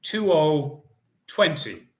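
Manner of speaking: slow sing-song number calling; bingo caller. Acted. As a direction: call each ball clearly, drawn out.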